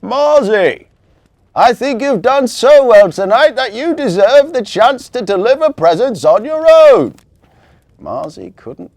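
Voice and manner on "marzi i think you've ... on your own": loud, higher call over the wind; calling across. A narrator's reading. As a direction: shout to her from the next sleigh. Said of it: "called across to her".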